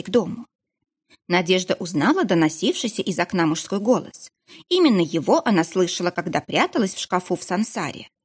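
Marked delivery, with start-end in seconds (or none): none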